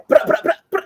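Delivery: high-pitched mock rapper gunshot noises; imitated gunfire ad-lib. Man parodying British hip hop, mock gunfire ad-libs. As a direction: rattle off rap gun sounds.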